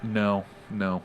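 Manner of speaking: ordinary talk, nothing marked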